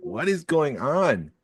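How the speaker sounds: high, drawn-out mock bewilderment; retold exasperation. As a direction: stretch it out in exasperated disbelief. Retold reaction to blaring commercials.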